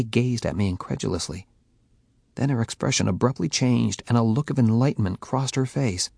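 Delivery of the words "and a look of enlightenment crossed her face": usual speaking voice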